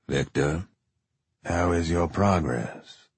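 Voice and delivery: a lightly voiced cold official; cold handler voice. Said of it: "cold and unbending like iron".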